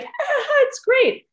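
hammed-up fake happiness with a laugh; mock fake cheer. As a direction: parody a forced, everything's-fine chirp. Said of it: "if we were always like".

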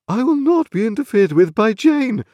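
high, loud affronted refusal; imperious lady, voice pushed high; haughty indignant mother. A narrator's reading. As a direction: declare it with injured dignity. Mother refuses child's interference.

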